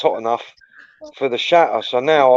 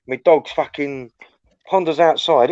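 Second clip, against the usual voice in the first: ordinary talk, nothing marked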